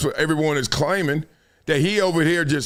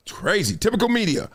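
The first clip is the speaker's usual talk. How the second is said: ordinary delivery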